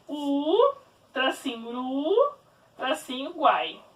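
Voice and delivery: "U", "ru", "guai" spoken as separate syllables with pauses. Shown as a demonstration. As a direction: split the word into syllables. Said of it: "vou separar em sílaba a palavra Uruguai". (separando "U", "ru", "guai" em sílabas)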